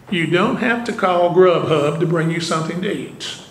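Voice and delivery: ordinary talk, nothing marked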